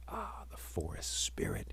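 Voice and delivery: unvoiced whisper under the breath; whispered to himself. A narrator's reading. Anansi whispering to himself behind the tree. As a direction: breathe the words as a secret aside. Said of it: "he said to himself".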